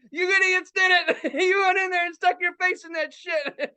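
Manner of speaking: high, squealing mockery through laughter; laughing hard. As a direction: imitate a cousin cracking up at idiots. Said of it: "He's laughing so fucking hard".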